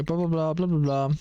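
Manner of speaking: normal talking voice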